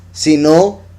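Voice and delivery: ordinary delivery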